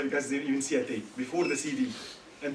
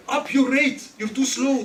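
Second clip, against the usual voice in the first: louder, higher snap of impatience; impatient husband; 8 semitones higher, 10 dB louder than usual. Retold or imitated. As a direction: hurry her along, fed up. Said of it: "That's the message I'm sending".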